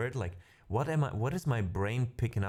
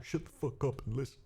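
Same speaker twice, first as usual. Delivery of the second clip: mock bossy; 5 semitones higher, 3 dB quieter than usual. Joking command to viewer.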